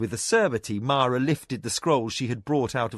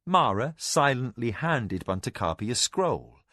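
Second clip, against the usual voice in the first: ordinary delivery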